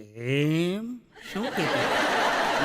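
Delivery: slow, drawn-out teasing probe, half laughing; jealous wife fishing for details, slow and knowing; mock sly wife. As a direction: imitate the wife's sarcastic questioning.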